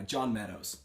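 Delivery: normal talking voice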